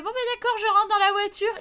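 mock innocent little girl; naive child agreeing. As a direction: go along happily like a trusting child. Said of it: "J'ai fait".

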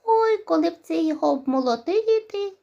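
high squeaky mouse voices; little mice. Acted. Mice wishing the wheat were threshed, high squeaky voice. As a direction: chirp it like tiny mice. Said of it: "мишенята та й кажуть".

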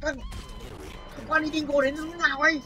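high startled cry. Real, not acted. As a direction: caught off guard by him. Startled by monster.

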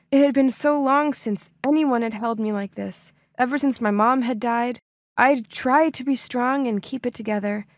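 usual speaking voice

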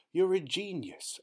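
high, thrilled praise; delighted cry. A narrator's reading. Aunt praising the boy's souffle, delighted. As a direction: burst out with delight. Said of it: "Aunt Glospan cried".

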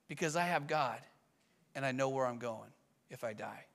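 low, soft, settled assurance; calm father. Retold or imitated. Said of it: "how can you be so calm".

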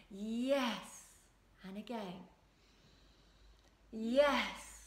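each "Yes" drawn out and nurturing, gentle beat; slow nurturing yes. Shown as a demonstration. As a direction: give "Yes" slowly, like a gentle drumbeat for yourself. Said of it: "Nice and slow so it's nurturing".

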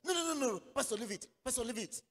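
high agitated pleading, loud at first; imitating friend's protest. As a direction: burst out refusing, then keep pleading high. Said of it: "That's the way he talks".

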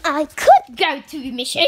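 ordinary delivery

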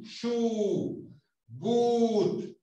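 SHOE and BOOT with long, rounded "oo"; U larga. Shown as a demonstration. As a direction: hold the vowel in SHOE and BOOT, lips pushed out. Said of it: "Poniendo la boca en posición de U". (SHOE y BOOT con U larga y redondeada)

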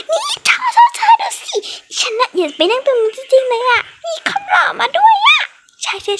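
very high, laughing squeal; girl gushing to her friend about the handsome boy; giddy squealing girl. Acted. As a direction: shriek with delight at the cute boy.

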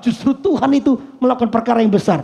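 loud, fervent preaching; preaching at full force. Acted. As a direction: drive the point home to the congregation, loud. Preaching to congregation.

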